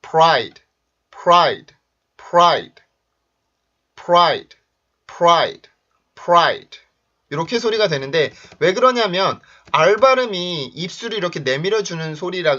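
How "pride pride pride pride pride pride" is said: crisp, stressed "pride" with a rounded pr onset; English pronunciation model. Shown as a demonstration. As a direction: model "pride" loudly, falling each time. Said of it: "자, 들어보세요".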